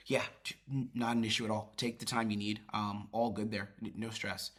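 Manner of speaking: soft, unhurried and reassuring; empathetic tone. Shown as a demonstration. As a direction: let them take their time, gently. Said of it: "There's the tone, empathetic, it's genuine".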